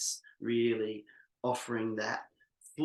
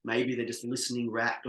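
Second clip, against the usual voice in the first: usual speaking voice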